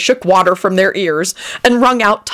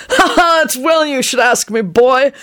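put-on eccentric old man; 9 semitones higher than usual. A narrator's reading. Old man bragging to Lupin, high boastful voice. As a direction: puff yourself up like a dotty old sailor.

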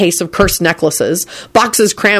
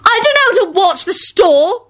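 very high voice, far from the narrator's; high-pitched character voice; 14 semitones higher than usual. A narrator's reading. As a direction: call it out high. Acted line, very high cry.

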